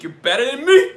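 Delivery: loud, high, rising mock outrage; mock indignation. Host jokingly confronting a commenter who teased him, in mock indignation. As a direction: fire it back as a hammed-up challenge.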